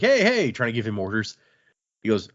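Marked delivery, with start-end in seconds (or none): 0.0-0.5 high-pitched mock call-out; guard calling after someone. Guard trying to order the man he chases, calling out. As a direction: call out as if chasing after him. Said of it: "trying to be like".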